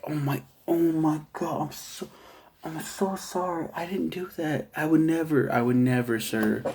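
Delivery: flustered, backpedaling to the cop; hammed-up nervous sorry; mock panicked apology. Elijah Wood apologizing to police.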